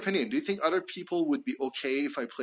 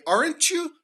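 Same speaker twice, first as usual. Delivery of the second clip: "Aren't you?" falling on "Aren't", jumping much higher on "you"; rising tag; 12 semitones higher than usual. Shown as a demonstration. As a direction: pitch "Aren't you?" high, climbing on "you". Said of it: "falling on the first R and it's rising".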